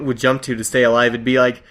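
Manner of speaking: ordinary talk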